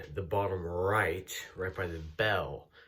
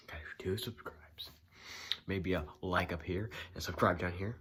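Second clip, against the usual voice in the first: ordinary talk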